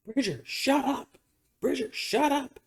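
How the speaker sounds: high-pitched mock holler, held back in volume; mock yelling. Imitating mom at the door to the barking dog, mock yell. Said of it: "yelling out the door".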